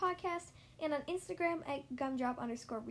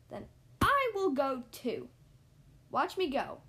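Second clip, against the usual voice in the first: defiant; 7 dB louder than usual. Acted. Gerald defiantly announces he will leave.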